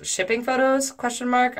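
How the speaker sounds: high, questioning read-out; puzzled questioning. Retold or imitated. Reading his confused text aloud, puzzled. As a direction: lift the whole query in mock puzzlement.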